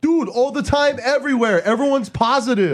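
very high imitated accent; Gaston accent impression. Comedian imitating a guy from Meltdown, accent impression. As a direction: put on an excitable, high-pitched accent. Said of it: "Gaston from Meltdown was like".

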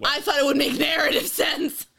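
loud, high protest through a laugh; mock indignant protest. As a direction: protest loudly in half-laughing defence. Mock protest at being teased as wrong.

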